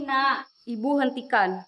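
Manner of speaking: ordinary talk, nothing marked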